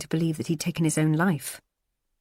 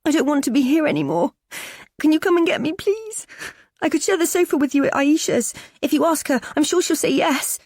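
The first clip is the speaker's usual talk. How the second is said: high, pleading and tearful; a girl begging to be fetched; desperate teen girl; 11 semitones higher, 4 dB louder than usual. Acted. Daughter begging her mum to come get her, desperate. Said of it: "the desperation in her voice".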